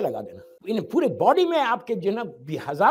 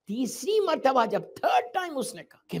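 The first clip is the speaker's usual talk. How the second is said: normal talking voice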